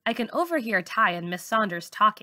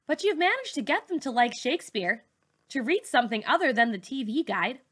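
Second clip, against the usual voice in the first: higher, earnest pleading voice; Ty's voice; 5 semitones higher than usual. Acted. Ty pleading with Miss Saunders not to flunk kids, high and earnest. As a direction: urge her not to give up on them.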